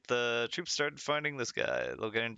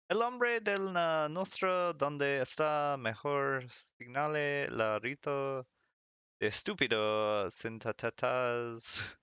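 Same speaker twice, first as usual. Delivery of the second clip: slow, clumsily read Spanish; butchered Spanish; slower than usual. Real, not acted. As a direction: stumble through Spanish with drawn-out words. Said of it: "Butchered the hell out of all of this".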